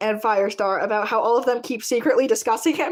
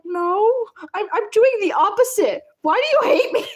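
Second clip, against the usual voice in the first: whiny pleading; 7 semitones higher than usual. Acted. Voicing Ashfur pleading, rising.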